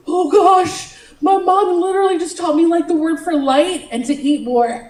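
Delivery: high, loud flustered panic; mock-distressed rush of self-reproach; retold freak-out. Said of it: "I freaked out".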